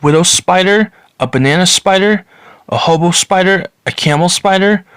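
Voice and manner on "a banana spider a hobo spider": normal talking voice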